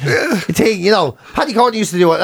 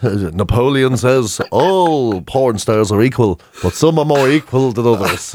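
mock solemn quote; 8 semitones lower, slower than usual. Mock quote of Animal Farm.

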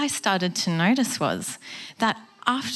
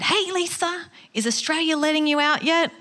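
higher, breezy friendly greeting; cheery American caller; 8 semitones higher than usual. Retold or imitated. Colleague emailing Lisa from America, a lightly voiced breezy greeting. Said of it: "he said".

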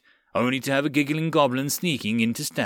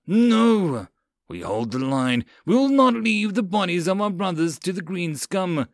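stubborn cry; 6 semitones higher than usual. Acted. Lieutenant refusing sergeant's plea to retreat, stubborn cry. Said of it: "Karath stubbornly cried".

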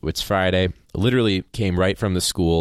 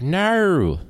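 "No" high and drawn out in a put-on Australian accent; 12 semitones higher, 3 dB louder than usual. Shown as a demonstration. Host showing how hard "No" is, strained Australian accent. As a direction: exaggerate the Australian vowel in "No". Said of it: "no in an Australian accent".

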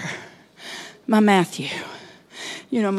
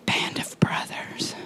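slower, drawn-out whisper; 9 dB quieter, slower than usual. Acted. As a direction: stretch the whisper, let it linger.

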